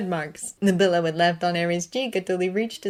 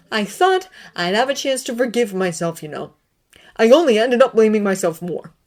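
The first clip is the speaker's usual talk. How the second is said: louder, bitter, rising at the end; bitter confession; 4 semitones higher, 8 dB louder than usual. A narrator's reading. Severus confessing to Dumbledore, bitter and loud. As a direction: spit out the self-blame. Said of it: "looked at him hatefully".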